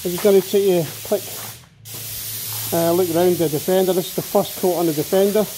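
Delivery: talking through a face mask; muffled behind a mask. Real, not acted. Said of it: "Excuse my muffled voice, I'm still wearing a mask".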